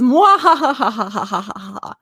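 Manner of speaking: slow maniacal laugh read aloud; mad scientist. Acted. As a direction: cackle like a villain. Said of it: "maniacal laughter helps a bit".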